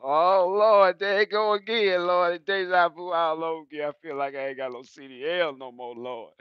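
loud, high cry to the Lord; comic lament. Retold or imitated. Driver moans to the Lord at the scale, loud and high. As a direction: plead with heaven, hammed-up. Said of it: "I said".

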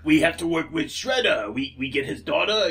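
put-on botched Russian-New York henchman accent; muddled Russian accent. Henchman talking about Shredder, muddled Russian accent. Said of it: "general purpose, wrong turn in New York accent".

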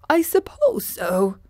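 doubtful straw man; unsure Scarecrow. Acted. Scarecrow answers Dorothy about the flowers, unsure.

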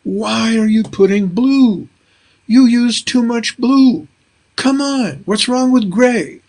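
a light take on a fussy mother; imitating his mother. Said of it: "I can hear her telling me".

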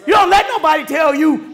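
high, loud preacher's call on sacrifice; full-force preaching. Acted. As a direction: shout it out to the congregation like a preacher in full flight.

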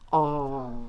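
low, drawn-out mock pity; sympathetic aw. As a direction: lead the audience in a long aw. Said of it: "Let's all say".

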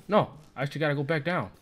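usual speaking voice

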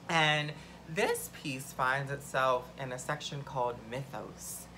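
ordinary talk

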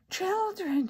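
high-pitched delighted exclamation; surprised cry. A narrator's reading. Old lady discovers children at her door, crying out. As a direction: exclaim it in astonishment without raising volume. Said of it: "she cried".

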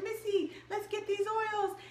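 light mock of a cheerful friend inviting her; imitating friend. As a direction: lift the voice up for a chirpy friend. Friend Rika voiced in story.

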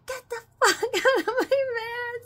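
screamed, high and loud with a laugh; angry scream breaking into a laugh; yelling. Retold or imitated. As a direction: scream them out of the van. Yelling at intruders in van.

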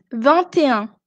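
"vingt et un" with T linked into "et"; sounded T liaison. Shown as a demonstration. Teacher shows learners the number twenty-one, liaison T in "vingt et un". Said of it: "ici je prononce".

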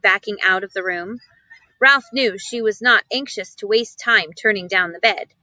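usual speaking voice

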